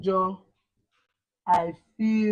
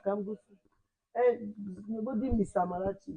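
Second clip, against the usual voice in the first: ordinary delivery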